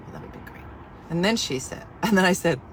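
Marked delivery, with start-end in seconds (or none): 0.1-0.6 breathy whisper; tired customer whispering her reply. Retold or imitated. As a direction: whisper it, defeated.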